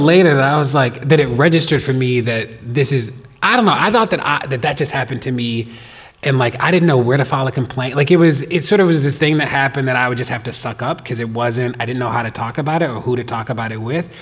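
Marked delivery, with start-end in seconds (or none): none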